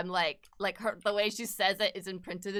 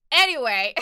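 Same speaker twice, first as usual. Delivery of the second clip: loud, higher burst through laughter; laughing; 10 semitones higher, 11 dB louder than usual. Real, not acted. Streamer laughing at themselves, laughing. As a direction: laugh it out loudly.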